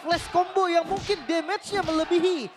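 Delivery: high, loud, slow hype; hyped caster call. Real, not acted. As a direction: sell the big moment. Caster hypes triple kill.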